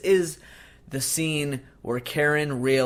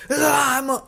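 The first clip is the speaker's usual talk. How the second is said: high, louder mock doll shout; Chucky outburst; 13 semitones higher, 6 dB louder than usual. Chucky reveals he is alive to Karen, loud mock shout. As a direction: burst out as the living doll. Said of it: "he's like".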